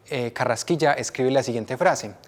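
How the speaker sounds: ordinary delivery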